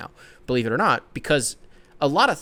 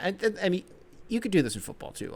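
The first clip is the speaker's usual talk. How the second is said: normal talking voice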